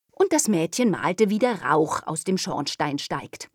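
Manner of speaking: ordinary delivery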